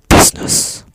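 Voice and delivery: Business whispered in a close ASMR hush. Pretend. Repeating the word for viewers as a whisper. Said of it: "Shall I say it again?".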